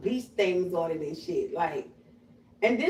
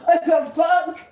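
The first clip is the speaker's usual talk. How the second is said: shocked outburst; 8 semitones higher, 7 dB louder, much slower than usual. Real, not acted. Shocked outburst after laugh.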